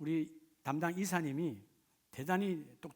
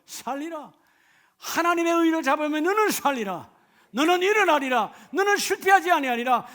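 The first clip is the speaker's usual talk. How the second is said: loud, high, fervent preaching; full-force preaching; 9 semitones higher, 11 dB louder than usual. Acted. Pastor pleading with the congregation, loud fervent preaching.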